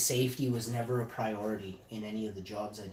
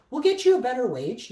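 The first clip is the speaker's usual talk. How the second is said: high-pitched, hurried-to-please appeasing voice; imitated placating bosses; 12 semitones higher, 9 dB louder than usual. Management promises worker a better wage, high placating voice. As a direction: mimic management soothing a complainer, pitched way up.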